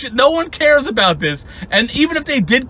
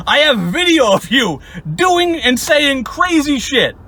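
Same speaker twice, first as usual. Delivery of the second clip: emphatic shout; 4 semitones higher than usual. Real, not acted. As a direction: shout it out slowly, word by word. Stresses he has video evidence.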